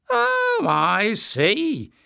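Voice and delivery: very high, mock-impressed voice; amused mockery. A narrator's reading. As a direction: feign being impressed in a high voice. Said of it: "eyes were glittering with amusement".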